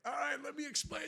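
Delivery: high-pitched placating plea; re-enacted pleading. Driver trying to explain to angry cop, pleading. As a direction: try to get a word in with the cop.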